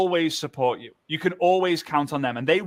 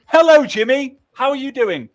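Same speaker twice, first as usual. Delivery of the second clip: much higher and louder, exaggerated stiff hello; mock formal greeting; 9 semitones higher, 9 dB louder than usual. Imitating a stiff greeting to a friend. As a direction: ham up a fake formal greeting. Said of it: "Like, imagine".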